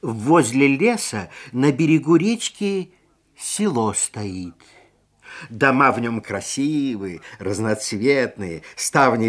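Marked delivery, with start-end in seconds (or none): none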